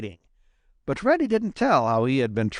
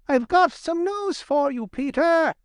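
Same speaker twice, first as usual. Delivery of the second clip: high-pitched, eager little chuck voice; eager call. A narrator's reading. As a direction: burst to share news, pitched high. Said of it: "called Johnny Chuck eagerly".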